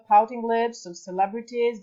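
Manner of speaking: usual speaking voice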